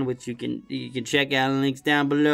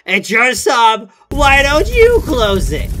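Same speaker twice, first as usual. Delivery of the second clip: loud, high, slow shout; imagined yell; 8 semitones higher, 9 dB louder, slower than usual. Pretend. Worker imagining yelling at the customer, loud and slow. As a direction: yell it from the counter. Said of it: "wanted to stand up on the counter and yell".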